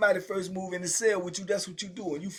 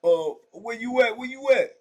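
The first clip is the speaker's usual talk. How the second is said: raised, louder imitation call; hollering back; 7 semitones higher, 5 dB louder than usual. Imitating the cellmate answering from the door, hollered. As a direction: call it out as if down a cell block. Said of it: "he hollering it back out".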